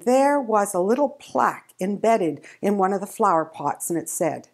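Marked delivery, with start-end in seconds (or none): none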